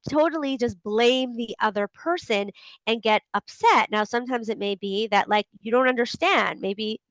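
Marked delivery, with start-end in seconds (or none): none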